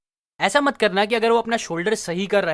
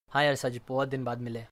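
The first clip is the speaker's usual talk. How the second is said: low, hushed, emotional answer; emotional reply; 8 semitones lower, 9 dB quieter than usual. Shown as a demonstration. Replying to a friend in the same tone, emotional. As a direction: answer softly and low, moved by the meeting. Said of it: "आप भी emotional tone में बोलो".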